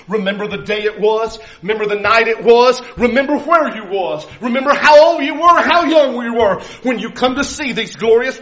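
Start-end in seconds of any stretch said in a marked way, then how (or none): none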